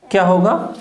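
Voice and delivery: ordinary talk, nothing marked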